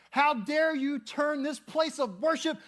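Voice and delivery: high, loud outraged shout; acted righteous fury at full volume; furious yelling. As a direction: yell it like someone flipping tables in rage. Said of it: "flipping tables and yelling".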